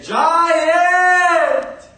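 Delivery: high, loud shout up at the giant; boy shouting. Acted. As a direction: call out to wake a giant. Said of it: "and the young boy said".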